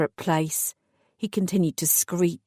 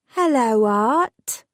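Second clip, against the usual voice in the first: sweet mocking coo; 4 semitones higher, 4 dB louder, much slower than usual. A narrator's reading. Fairy godmother greeting her terrified victim, cooing. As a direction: coo his name like a cruel tease. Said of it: "I cooed".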